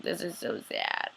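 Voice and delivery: low, soft and slow; mildly sad. Retold or imitated. Viewer recalling a sad scene, low subdued sadness. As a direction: sink into a quiet, drawn-out lament. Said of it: "I just thought it was really sad".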